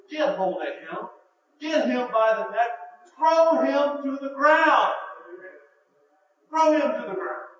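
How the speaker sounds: rising to a loud, forceful call to throw him down; rousing command. Acted. Preacher urging the church to fight back, loud and commanding. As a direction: build from firm to loud insistence. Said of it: "what Paul is saying in that passage is".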